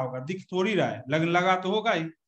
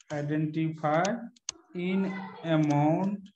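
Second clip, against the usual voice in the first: slow dictation; 3 dB quieter, much slower than usual. Acted. Dictates definition slowly to students.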